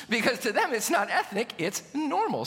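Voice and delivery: real laugh through the words. Real, not acted. Preacher laughing at his own food joke to the congregation, laughing. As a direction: chuckle while telling the punchline.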